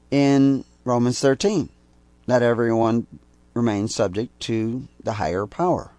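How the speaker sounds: usual speaking voice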